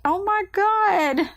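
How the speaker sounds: high, slow, choked-up cry; overwhelmed crying. Retold or imitated. Mother on phone hearing son's first goal, tearful high cry. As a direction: break down hearing the news. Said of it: "by then I'm just blubber crying".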